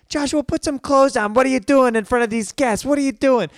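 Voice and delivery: high, loud mock maternal shriek; upset mom. Mom catching naked son before guests, shrieking in mock upset. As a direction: imitate a scandalized mother scolding. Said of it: "his mom gets so upset".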